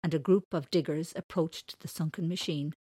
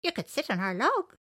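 high, louder creature voice; little elephant character; 9 semitones higher, 6 dB louder than usual. Acted. Elephant offers the man their log, high creature voice. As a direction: pipe it up high as a friendly animal.

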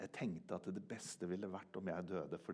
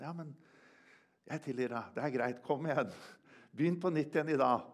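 rising, louder encouragement from God; forgiving voice growing louder and more urgent; God's encouraging voice; 5 semitones higher, 6 dB louder than usual. Acted. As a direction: lift him up, building to a louder urging to start again. Voicing God forgiving him.

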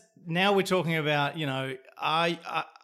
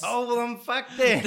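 high, loud laughing through words; 10 semitones higher, 10 dB louder than usual. Real, not acted. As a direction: talk while cracking up. Laughing at robot rule.

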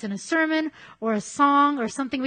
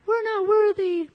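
high squealing fanboy "We're not worthy"; mock-worship it like a party-loving fan; hammed-up groveling fan; Wayne and Garth; 10 semitones higher, 4 dB louder than usual. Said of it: "like a Wayne and Garth kind of".

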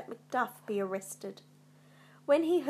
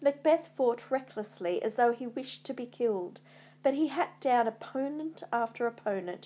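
ordinary delivery, nothing marked

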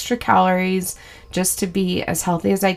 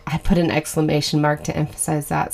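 ordinary talk, nothing marked

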